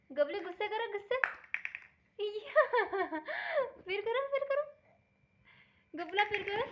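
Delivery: very high, loud coaxing with giggles; baby talk. Acted. Mother urging Bulla to do it again, giggly high baby talk. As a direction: cheer the little one on through laughter.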